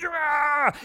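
high, loud growly "raah"; mock jabbing yell. Preacher acting out digging the poker in, jabbing yell.

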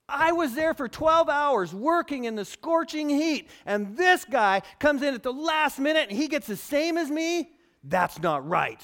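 indignant complaint. Retold or imitated. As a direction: rant like a worker cheated of pay. Voicing a resentful worker.

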